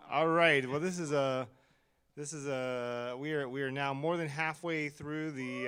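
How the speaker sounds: hyped host working the crowd; in front of an audience. Acted. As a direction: project out to a live crowd like an MC. Host hyping a live audience.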